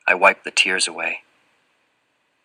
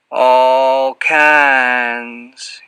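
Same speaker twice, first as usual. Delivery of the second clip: drawn-out Southern character voice, higher; lingering; 4 semitones higher, 6 dB louder than usual. A narrator's reading. Fat man hinting at rewards to the boy, lingering. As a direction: let each word linger, stretched long. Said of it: "his voice lingering in the twilight".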